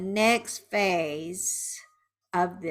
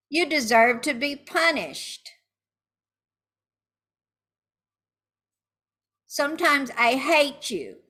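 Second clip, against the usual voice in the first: higher-pitched accusing anger; angry venting; 6 semitones higher, 4 dB louder than usual. Shown as a demonstration. Betrayed partner to the cheater, angry and accusing. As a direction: let the resentment out. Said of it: "saying angry words now".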